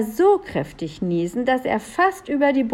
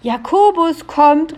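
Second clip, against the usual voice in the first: high, loud warning call; 8 semitones higher, 6 dB louder than usual. A narrator's reading. Little men announce an arrival, shouting. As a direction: call it out slowly and loudly. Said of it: "riefen die Heinzelmänner".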